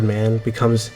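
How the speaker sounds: ordinary talk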